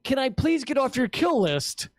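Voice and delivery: politely beg, with a comic edge; earnest little plea played for absurdity; pleading request. Plaintiff's plea to court, retold.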